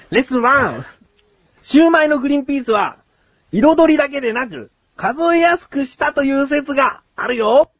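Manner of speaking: high, declaimed lesson summary; lesson announcement. Acted. Host summing up the guest's lesson, high announcer voice.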